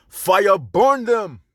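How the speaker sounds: fierce catchphrase delivered slowly and loud; fiery sign-off. Acted. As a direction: proclaim it like a curse from the pulpit.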